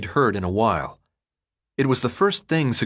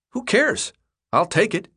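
put-on much higher kid voice, shrugging; dismissive boy; 10 semitones higher than usual. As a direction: toss it off like a careless kid. Chuck dismissing the question.

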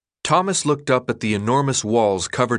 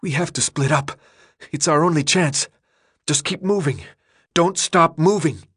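frightened command building to a yell; terrified urgency; 6 semitones higher than usual. Acted. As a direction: urge him to run in panic. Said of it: "eyes wide with terror".

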